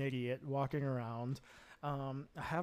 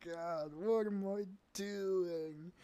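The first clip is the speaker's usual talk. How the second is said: higher, drawn-out embarrassed groan; retold mortified dismay; 4 semitones higher, slower than usual. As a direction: moan it slowly at your own mistake. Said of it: "It's just like".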